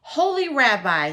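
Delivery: loud pleading cry of Holy Rabbi; cried out. Acted. Poor man begs the rabbi for help, loud pleading cry. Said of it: "he cried".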